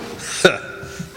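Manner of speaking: soft breathy laugh to himself; chuckle, pleased to see through it; quiet chuckle. Acted. Amused at being tested.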